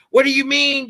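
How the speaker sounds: mock indignant critic; high, incredulous quoted critic; imitating a critic. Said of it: "people are criticizing me".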